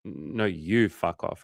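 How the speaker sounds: slow, hesitant comeback; drawn-out, understated mock retort. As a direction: stretch it out after a small stumble. Retold back-and-forth, his turn.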